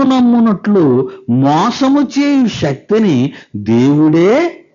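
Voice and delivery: drawn-out, high-pitched Bible reading; declaimed scripture reading. Acted. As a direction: intone the verse phrase by phrase. Reads the scripture aloud to congregation.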